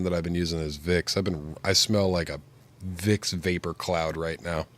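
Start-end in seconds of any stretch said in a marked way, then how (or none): none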